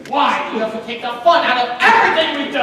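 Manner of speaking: whining, loud grievance; loud complaint. Acted. Husband protests wife spoiling his fun, loudly. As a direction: complain loudly like a sulking kid.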